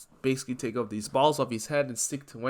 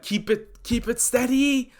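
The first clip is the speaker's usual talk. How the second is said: tense urging; 11 semitones higher, 4 dB louder than usual. Acted. Izuku bracing to throw bomb.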